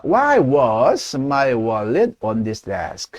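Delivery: very slow model question falling at the end. Shown as a demonstration. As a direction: spell it out slowly, "desk?" dropping. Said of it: "하면 되겠죠". (천천히 끝을 내리는 의문문)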